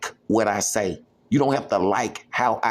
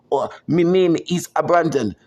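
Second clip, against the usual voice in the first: higher, louder, in a voice unlike his own; 5 semitones higher, 4 dB louder than usual. Shown as a demonstration. Showing critics he has many tones, higher and louder. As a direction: switch to a brighter, higher voice. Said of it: "when I speak in different tones".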